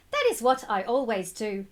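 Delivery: ordinary delivery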